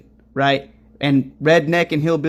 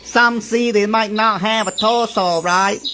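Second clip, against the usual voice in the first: lightly imitated Chinese accent, higher pitched; mock Chinese accent; 5 semitones higher than usual. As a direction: mimic the speaker's Chinese accent.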